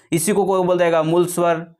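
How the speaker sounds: usual speaking voice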